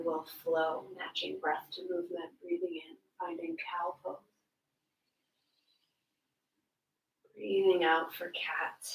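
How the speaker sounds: soft, spaced-out instructions with long pauses; unhurried, hushed yoga teacher; yoga guidance. Real, not acted. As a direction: guide the breathing quietly, leaving room to move. Leads breath-matched yoga flow.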